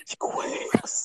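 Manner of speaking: breathy dramatic whisper. Pretend. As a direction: whisper it like a trailer.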